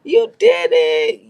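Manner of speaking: high, slow excited cheer. Retold or imitated. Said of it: "T K was like".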